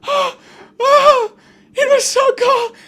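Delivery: put-on extremely high, loud London-accented gasps and sobs; hammed-up London-accented whimpering; wailing London accent. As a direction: cry out like a squeaky London-accented toy. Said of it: "he has a London accent".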